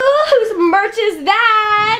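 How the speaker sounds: high, laughing delight; laughing surprise. Real, not acted. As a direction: squeal with laughter.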